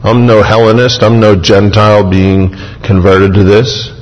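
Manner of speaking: ordinary delivery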